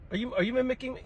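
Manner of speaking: high incredulous question; indignant disbelief. Real, not acted. Teacher indignant that a child mimics him. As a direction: ask it in outraged disbelief.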